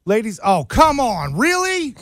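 exasperated minister. Retold or imitated. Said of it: "does he go".

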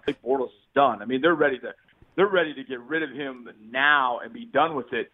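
ordinary delivery

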